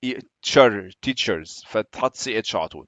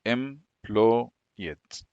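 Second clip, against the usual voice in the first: very slow, softer "Unemployed" in separate chunks; spelling-out pace; 2 semitones lower, 5 dB quieter, much slower than usual. Shown as a demonstration. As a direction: dictate "Unemployed" syllable by syllable as if writing it. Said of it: "تكتب إزاي؟".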